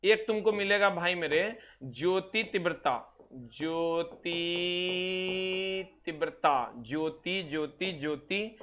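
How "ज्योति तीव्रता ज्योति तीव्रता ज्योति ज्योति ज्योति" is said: drawn-out, softer dictation of each word; slow dictation. Shown as a demonstration. Teacher dictating terms to students, slow and softer. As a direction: spell it out slowly for note-taking.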